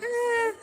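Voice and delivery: brief, high little wail, imitating a baby; soft little baby cry. Mother imitating her first baby's cry, a soft little whimper. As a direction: mimic a small, calm baby's cry. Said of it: "He had the softest little calm cry".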